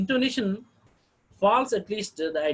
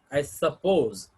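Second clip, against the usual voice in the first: usual speaking voice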